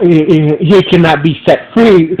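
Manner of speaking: ordinary talk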